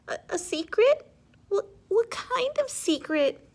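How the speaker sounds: put-on curious tearful child. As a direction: sniffly kid perking up. Child stammers, cried before.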